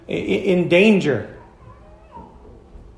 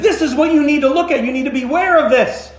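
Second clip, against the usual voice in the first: urgent, higher-pitched warning; insisting with conviction; 8 semitones higher, 4 dB louder than usual. Acted. Preacher acting out warning someone who is in danger, insistent. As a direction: press the warning home forcefully. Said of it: "insist with strength, with con-, with, uh, conviction".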